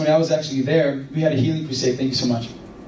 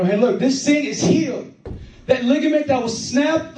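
much higher, exclaiming retold testimony; excited testimony, imitated; 10 semitones higher than usual. Healed girl showing everybody her ligament, imitated excitement. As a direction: show everyone the healing, voice pitched way up.

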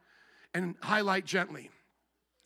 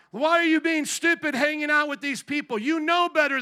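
a firm parent scolding out of love, higher and louder; stern loving parent; 6 semitones higher, 6 dB louder than usual. Retold or imitated. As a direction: scold like a caring parent who wants better for you. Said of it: "good parents be stern with you".

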